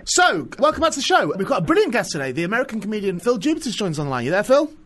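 radio host intro. Acted. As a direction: launch the segment like a big show opener. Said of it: "Here we go".

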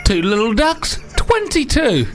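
lightly voiced bingo call; bingo caller. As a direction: sing out the number like a caller. Imitating bingo calls.

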